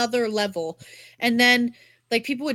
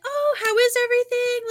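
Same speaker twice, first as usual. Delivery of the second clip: very high, sing-song waitress imitation; sweet server; 13 semitones higher than usual. Waitress checking on her table, imitated in a sugary high voice. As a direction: mimic an over-the-top sweet server, way up high. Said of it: "so sweet. Would check in".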